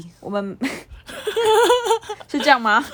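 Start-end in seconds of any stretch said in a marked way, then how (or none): 1.4-3.0 high laughter breaking through the words; laughing. Real, not acted. Teased about being a T. (边笑边说)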